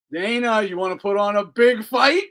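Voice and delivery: ordinary talk, nothing marked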